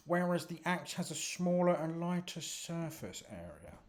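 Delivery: a nerdy medic explaining sparks; earnest know-it-all medic. Acted. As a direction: lay out the science a bit too thoroughly.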